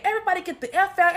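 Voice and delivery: loud, fast mock yell; retold yell. Sister kicking the family out with a loud yell. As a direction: order everyone out in a shout.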